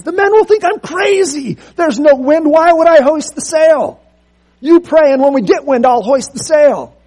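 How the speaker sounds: a flustered captain pushing back; alarmed protest. Acted. Ship's captain objecting to Taylor, high agitated protest.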